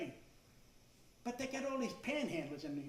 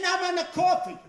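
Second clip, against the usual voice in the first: a sharp, much higher and louder shout; exasperated yell; 9 semitones higher, 12 dB louder than usual. Retold or imitated. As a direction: shout at the barista that you just want coffee. Fed-up customer yells at barista.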